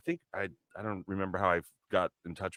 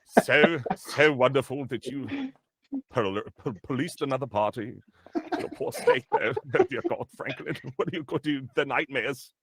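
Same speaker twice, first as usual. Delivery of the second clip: hammed-up private voice, leaping high; Frank's private real voice; 5 semitones higher, 9 dB louder than usual. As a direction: drop the tough act, lurch into high-pitched self-talk. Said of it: "that's probably not even his real voice".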